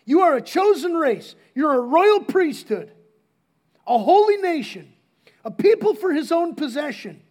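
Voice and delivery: preaching the verse with force; proclaiming scripture. Acted. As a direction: declare it to the room.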